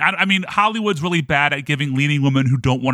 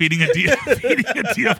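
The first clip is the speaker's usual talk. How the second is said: laughing; 9 semitones higher than usual. Real, not acted. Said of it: "I forgot about that".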